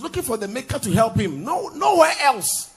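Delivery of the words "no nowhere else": slow, loud cry of nowhere else; preaching shout. Real, not acted. Preacher to congregation, nowhere else in a slow shout.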